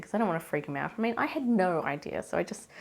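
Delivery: ordinary talk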